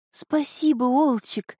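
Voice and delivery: high, heartfelt thanks; sincere exclamation. A narrator's reading. Girl thanks the wolf for finding the youth, sincere exclamation. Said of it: "искренне воскликнула Снежана".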